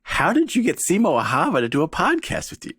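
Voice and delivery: ordinary talk, nothing marked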